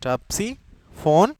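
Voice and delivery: Topsiphone paradisei drawn out very slowly, syllable by syllable; teacher dictating a scientific name, very slowly; slow dictation. Shown as a demonstration. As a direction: spell it out slowly so students can write it down. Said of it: "इसका scientific name है".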